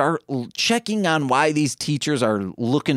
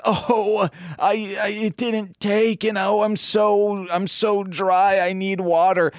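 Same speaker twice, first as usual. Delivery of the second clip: higher-pitched mock-distressed teacher; 4 semitones higher than usual. Teacher pleading for water to a colleague, mock-distressed. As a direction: play up the teacher's stammering complaint, higher.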